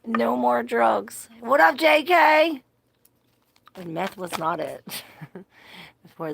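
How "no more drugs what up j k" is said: playful shout-out. Real, not acted. Teasing chat, greeting viewer.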